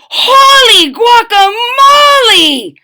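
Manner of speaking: very high, loud, slow startled cry; startled shout. A narrator's reading. Kitten startled by his mirror, shouting. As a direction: shout out in shock. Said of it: "exclaimed Skippy John Jones".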